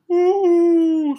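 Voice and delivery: loud high ghost whoo; spooky ghostly woo. Pretend. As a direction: howl it like a ghost. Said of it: "Spooky voice".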